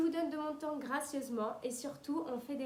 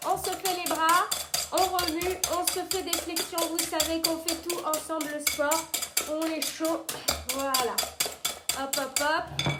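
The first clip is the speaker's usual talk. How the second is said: hyped workout cheer; 4 semitones higher, 8 dB louder than usual. Acted. Pumped up, mixing as workout.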